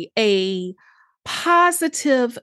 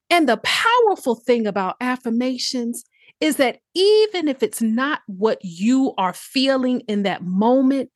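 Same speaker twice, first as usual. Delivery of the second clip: ordinary talk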